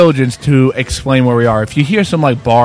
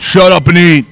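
mock coach's yell, pitched higher; imitated yell; 5 semitones higher, 4 dB louder than usual. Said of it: "yelling at you from the side".

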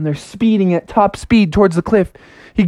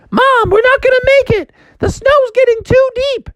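put-on squeaky worried child; Froggy warning mom, very high; very high kid voice; 3 dB louder than usual. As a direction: warn your mom urgently.

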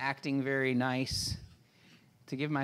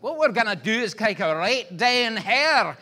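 loud, higher Scottish coach driver; strong Scottish accent; 7 semitones higher, 9 dB louder than usual. Retold or imitated. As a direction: belt it out in thick Scottish. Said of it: "it was that strong of an accent".

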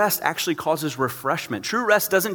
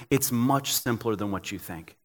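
gentle voice of God; 6 semitones lower, 6 dB quieter than usual. Retold or imitated. God gently tells a worn-out pastor, low and soft. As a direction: lower and softer, a patient fatherly reassurance. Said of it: "God's sitting there going".

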